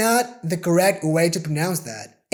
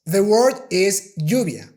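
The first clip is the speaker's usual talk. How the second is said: ordinary delivery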